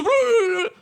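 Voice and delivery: very high, loud "blah" shriek; mock jump-scare scream. Dude jumps up in the scary video, screaming. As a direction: imitate a screamer video popping up. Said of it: "a dude jumps up and goes".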